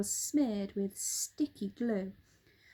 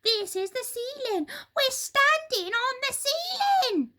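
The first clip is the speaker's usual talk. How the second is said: panicked Missus Twit; 7 dB louder than usual. A narrator's reading. Missus Twit realising they stand on the ceiling, high panicked shriek. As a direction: shriek out the horror word by word.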